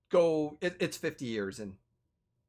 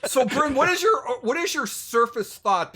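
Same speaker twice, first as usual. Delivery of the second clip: higher and louder, eager question; keen voice pressing a friend; excited; 8 semitones higher, 5 dB louder than usual. Real, not acted. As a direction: burst in with the question. Excited question to player character.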